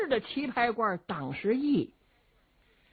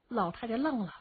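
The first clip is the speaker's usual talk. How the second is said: ordinary delivery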